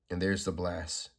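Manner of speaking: ordinary delivery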